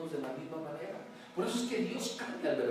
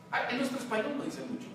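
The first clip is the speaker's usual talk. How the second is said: ordinary delivery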